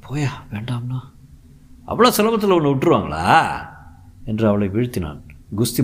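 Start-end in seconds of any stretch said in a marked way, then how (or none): 0.1-1.0 breathy hushed plea, voice unvoiced; whispered refusal. Bride whispering to her husband to stop. 1.9-3.2 mocking. Husband overpowering his bride.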